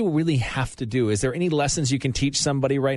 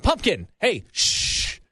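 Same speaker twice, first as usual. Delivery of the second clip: calling the dog; 5 semitones higher, much slower than usual. Retold or imitated. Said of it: "let's use my dog, for instance".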